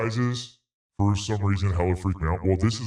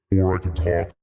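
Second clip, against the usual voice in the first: voice filter; 3 dB louder than usual. Shown as a demonstration. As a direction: voice changer filter. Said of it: "I have a filter on it that changes how you hear me".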